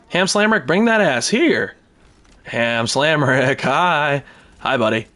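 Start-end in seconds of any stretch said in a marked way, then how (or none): 0.1-1.7 calling out. Real, not acted. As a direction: call him over, teasing. Calling out to opponent.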